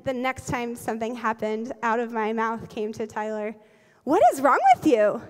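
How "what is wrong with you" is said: loud retold parental scolding; exasperated scolding. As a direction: scold a child in mock exasperation. Retold snapping at her son.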